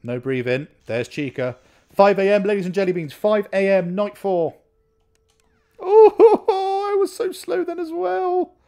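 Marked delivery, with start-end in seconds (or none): 1.9-4.5 loud, raised announcer-like call; excited announcement. Real, not acted. Player announcing five a.m. to viewers, excited. 5.8-8.5 very high wail breaking into laughter; high-pitched wail. Real, not acted. Player realising they were too slow, high-pitched wail. Relief after a scare in game.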